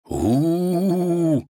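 long, high wolfish howl; quiet wolf howl. A narrator's reading. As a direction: howl on one drawn-out "oo". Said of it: "тихонько завыл".

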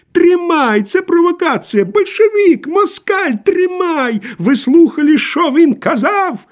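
high-pitched, cracked, angry cry; spiteful, whining shout. A narrator's reading. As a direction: yell it high, mean and tearful. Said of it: "злобный и плаксивый голос". (злобный, плаксивый, надтреснутый крик)

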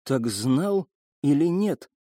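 trembling voice. A narrator's reading. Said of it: "голос её задрожал".

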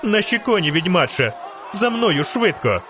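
high-pitched urgent call to follow; urgent cry. A narrator's reading. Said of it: "крикнула Мільва". (наполегливий вигук високим голосом)

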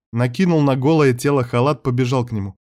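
ordinary delivery

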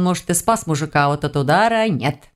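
ordinary delivery

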